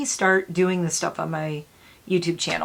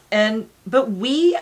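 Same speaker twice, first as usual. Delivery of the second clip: ordinary talk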